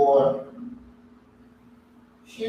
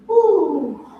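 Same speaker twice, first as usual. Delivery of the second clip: excited whoop; 10 semitones higher, 5 dB louder than usual. Real, not acted. Whoop during stretch.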